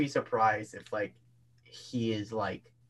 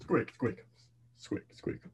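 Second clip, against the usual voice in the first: cartoon character doing squeak talk; short squeak words, dropping to a near-whisper; Kronk; 4 dB quieter, slower than usual. Showing off an impression on request, Kronk. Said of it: "All right, Kronk".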